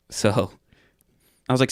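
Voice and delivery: ordinary talk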